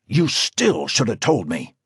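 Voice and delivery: stern rebuke; harsh. A narrator's reading. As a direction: scold him sharply. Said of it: "he said, harshly".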